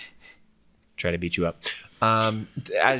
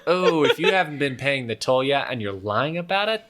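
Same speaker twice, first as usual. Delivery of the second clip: higher-pitched swindler catching out a liar; toll collector, mock concern; 5 semitones higher, 4 dB louder than usual. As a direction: react like a toll-taker catching a cheat. Game master plays toll collector.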